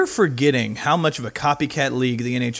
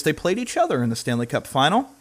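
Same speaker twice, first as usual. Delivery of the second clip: ordinary delivery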